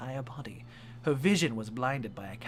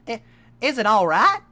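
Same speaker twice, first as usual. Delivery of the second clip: much higher, loud worried pony question; Applejack; 9 semitones higher, 18 dB louder than usual. Acted. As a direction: ask it like a nervous cartoon pony. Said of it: "Applejack asked Twilight".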